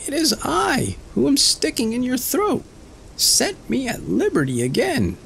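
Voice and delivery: tiny Tom pleading. A narrator's reading. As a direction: plead to be let out. Tom pleads from fox's throat.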